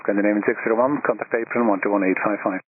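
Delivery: ordinary talk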